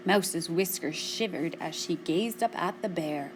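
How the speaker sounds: plain reading voice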